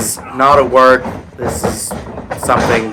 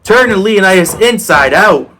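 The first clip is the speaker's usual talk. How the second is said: loud, high rising excitement; excited; 6 semitones higher, 4 dB louder than usual. Real, not acted. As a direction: call the clothesline like a big hit. Big move in wrestling match.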